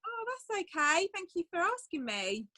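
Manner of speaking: ordinary delivery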